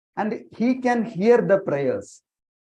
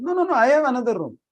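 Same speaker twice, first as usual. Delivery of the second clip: very fast, high-pitched protest; insistent reassurance; 8 semitones higher, 4 dB louder, much faster than usual. Acted. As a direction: rattle it off high to wave away the question. Acted hostess insisting she has room.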